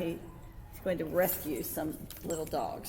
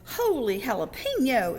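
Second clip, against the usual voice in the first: higher, louder storybook outburst; exclaiming; 6 semitones higher, 7 dB louder than usual. A narrator's reading. Kitten crashes into stuffed animals, exclaiming. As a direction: burst out with a startled kitten's exclamation. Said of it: "exclaimed Skippy John Jones".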